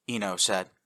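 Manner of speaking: ordinary delivery, nothing marked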